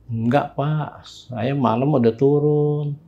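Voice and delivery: soft, slow, deferential reply; meek answer. Retold or imitated. Young hiker explaining himself to the neighbourhood head, meekly. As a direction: answer the elder quietly, drawing the words out.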